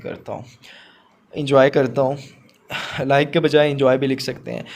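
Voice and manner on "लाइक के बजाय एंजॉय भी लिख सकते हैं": ordinary talk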